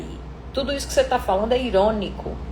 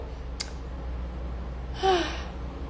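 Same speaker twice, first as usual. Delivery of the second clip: relieved sigh; 7 semitones higher, 13 dB quieter than usual. Shown as a demonstration.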